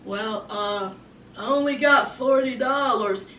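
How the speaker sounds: sheepish low voice; imitating Sean. Sean admits to Destiny he's short, low sheepish voice. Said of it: "Sean says".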